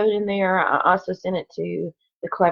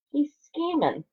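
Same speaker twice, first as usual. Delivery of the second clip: usual speaking voice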